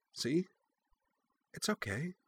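almost breathe it to calm the pup; hushed reassurance; soft soothing. A narrator's reading. Cora soothing the puppy.

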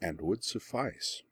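very soft, slow purr. A narrator's reading. Azula finishing her verse to pinned Benny, purring. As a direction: let it drop to a slow, hushed purr. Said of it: "Azula purred".

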